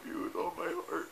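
soft real whisper, tearful goodbye; whispered tender goodbye. Real, not acted. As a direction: breathe it, just a whisper to your love. Tender farewell to partner.

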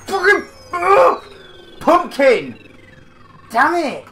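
high loud frightened yelling; panicked swearing; startled scream. Real, not acted. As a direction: shriek at the jump scare. Jump scare, swearing.

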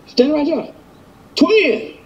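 high call across the room; yelling across the room. Real, not acted. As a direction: call her over from afar. Calling the twin across the room.

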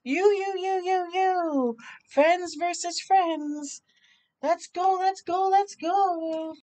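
rally the team; excited kid cheering. Acted. Boys pick teams and cheer each other on, excited.

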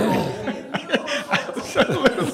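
a big burst of laughter; loud laugh. Real, not acted. Pastor laughing at his own line about teens, loud laugh. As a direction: crack up at your own joke.

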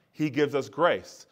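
ordinary talk

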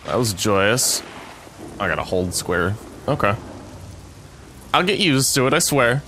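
ordinary talk

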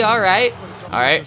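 ordinary delivery

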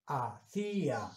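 "Hacía" said slowly as a-CÍ-a; syllable-split hiatus. Shown as a demonstration. Teacher showing pupils how the word is pronounced, syllable-split hiatus. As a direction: draw out the í of "Hacía", apart from the a. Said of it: "debe pronunciar".

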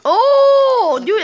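high, loud sudden "oh"; startled delight; surprised gasp. Real, not acted. Sudden find in game.

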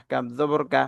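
ordinary talk

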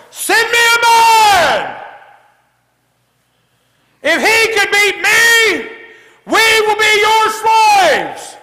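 bellowing giant taunt; Goliath's taunt. Acted. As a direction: roar it across the battlefield like a giant.

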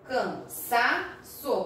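"Cansaço" drawn out, a gap after each syllable. Shown as a demonstration. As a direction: say "Cansaço" very slowly, syllable by syllable, middle one strong. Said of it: "Qual é a sílaba mais forte?".

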